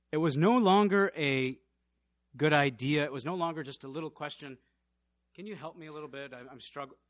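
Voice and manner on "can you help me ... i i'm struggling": mild request. Acted. Mild polite request, contrasted.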